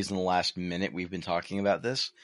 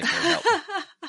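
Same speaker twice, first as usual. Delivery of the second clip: laughing; much slower than usual. Real, not acted. Laughing high.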